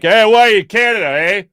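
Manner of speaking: mock Canadian accent. Imitating a Canadian border guard in a mock Canadian accent. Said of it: "he was like".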